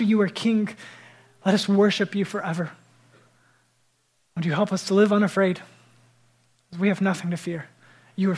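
earnest congregational prayer. Acted. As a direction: lift it up as worship. Pastor leads closing prayer.

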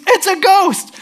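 high, loud scream of alarm; scream of fright. Acted. A fisherman spots a figure on the water, scream of fright. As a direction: shriek it as if terrified. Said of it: "Someone screams".

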